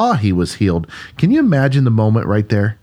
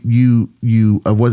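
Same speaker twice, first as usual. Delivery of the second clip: ordinary talk, nothing marked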